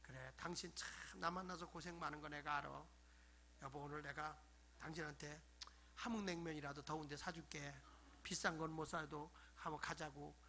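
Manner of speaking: low, soft, affectionate murmur; tender husband. Acted. Husband offering his wife cold noodles, tender and soft. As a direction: speak gently and consolingly to a spouse. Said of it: "좀 애교스럽게 아내도 좀 대해주면".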